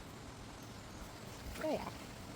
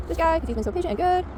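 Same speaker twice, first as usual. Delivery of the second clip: higher praise for the dog; 10 semitones higher, 8 dB louder than usual. Real, not acted. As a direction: lift it up, praising.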